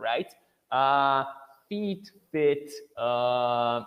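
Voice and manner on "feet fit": "feet" with a long ee, "fit" with a short i; vowel length contrast. Shown as a demonstration. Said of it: "those differences, they are kinda hard".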